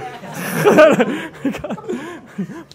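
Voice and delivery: loud burst of laughter, rising; laughing out loud at the joke; real laugh. Real, not acted. Teacher cracks up at the absurd answer, loud laughter.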